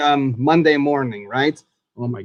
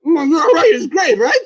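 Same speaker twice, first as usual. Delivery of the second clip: excited; 9 semitones higher, 4 dB louder than usual. Real, not acted. Agreeing about the corn dog.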